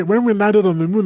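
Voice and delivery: ordinary talk, nothing marked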